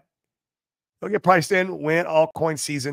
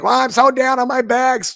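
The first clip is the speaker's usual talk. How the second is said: high, louder mocking whine; imitating a moping crypto holder, pitched up; mock whiny holder; 8 semitones higher, 4 dB louder than usual. Mocking holders who complain about their bags, in a high whine. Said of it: "So people are like".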